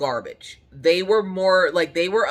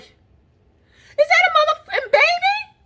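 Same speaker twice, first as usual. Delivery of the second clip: high shrieked disbelief, much louder; over-the-top dramatic shriek; super dramatic, loud; 6 dB louder, slower than usual. Retold or imitated. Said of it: "I might have been super dramatic when I said it".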